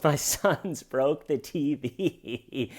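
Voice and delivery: slow, giggling mimic; laughing through words. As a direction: giggle through the line like her. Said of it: "if she goes".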